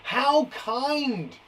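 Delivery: high-pitched "how kind"; surprised exclamation. Shown as a demonstration. Teacher modelling an exclamation sentence, high surprised exclamation. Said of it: "used when someone is surprised".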